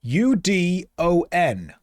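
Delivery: slow letter-by-letter spelling; spelled out slowly. Shown as a demonstration. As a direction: spell out each letter patiently. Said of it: "Look at the letters".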